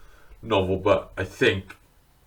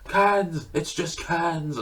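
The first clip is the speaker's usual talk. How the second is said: higher, slow, hushed Keanu awe; Keanu impression; 9 semitones higher, much slower than usual. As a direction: marvel at the cans like stunned Keanu. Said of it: "and then he's like".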